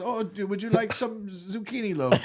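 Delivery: high, slow mock German accent; drawn-out, hammed-up German offer. German assistant offers the drummer's loaf in a high, slow German accent. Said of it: "like, a German guy".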